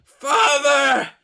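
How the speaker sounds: high, loud wail calling up from below; starving ghostly son shrieking for his father; desperate shout. Acted. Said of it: "is shouting".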